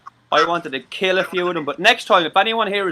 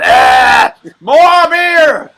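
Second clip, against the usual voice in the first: put-on much higher, louder drunken cry; mock drunk; 11 semitones higher, 13 dB louder, much slower than usual. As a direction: ham up a drunk begging beer. Mock drunk demanding beer.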